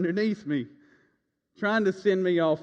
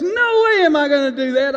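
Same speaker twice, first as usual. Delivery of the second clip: wife refusing; 9 semitones higher, 10 dB louder than usual. Retold or imitated. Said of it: "And she goes".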